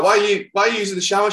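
high, loud and fast, aghast; shocked question. Retold or imitated. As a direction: blurt it out in disbelief. Shocked at naked landlady.

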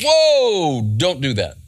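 imitated startled "whoa", pitched high then dropping; alarmed objection. Angel stops John bowing down to him, alarmed objection.

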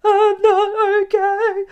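loud, high, drawn-out wail; hammed-up sobbing breakdown; mock crying. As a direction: wail it slowly as if bursting into tears. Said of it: "like, cried".

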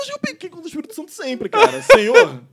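high, loud laughing confession. Real, not acted. Joking with friend about sinning, laughing high and loud. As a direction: crack up while admitting it.

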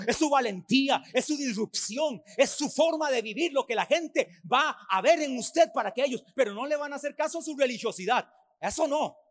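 loud, rising preacher's list, each phrase punched; preaching at full force. Acted. Pastor exhorts believers that their life testifies, loud and rousing. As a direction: build the list like a sermon climax.

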